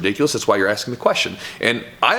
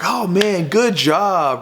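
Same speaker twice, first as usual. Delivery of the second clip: higher-pitched mock amazement; imitated admiring praise; 7 semitones higher, much slower than usual. Relatives gathering round the swimming cousin, imitated admiration. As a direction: imitate an impressed crowd cheering someone on.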